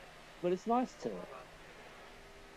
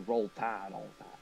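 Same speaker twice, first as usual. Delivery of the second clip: put-on southern accent; 4 semitones lower than usual. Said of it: "I sound like I'd wanna talk".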